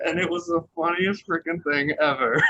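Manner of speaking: tickled and giggly; laughing while talking. Real, not acted. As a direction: crack up as you say it. Cracking up at own impression.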